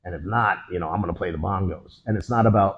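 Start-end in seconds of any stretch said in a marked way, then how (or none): none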